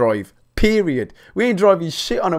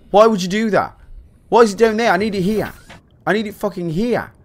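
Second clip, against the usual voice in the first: high-pitched, indignant outburst; exasperated rant; 6 semitones higher, 3 dB louder than usual. Real, not acted. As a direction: rant in outrage at whoever set this up. Ranting at the game's makers.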